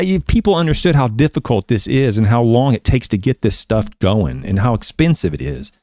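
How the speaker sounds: ordinary talk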